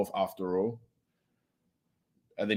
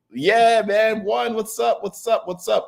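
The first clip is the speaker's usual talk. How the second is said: high, lively hello to chat; upbeat streamer welcome; excited greeting; 11 semitones higher, slower than usual. Real, not acted.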